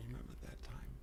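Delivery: soft whisper as the body; hushed inner-voice whisper. Retold or imitated. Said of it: "When the body tells you".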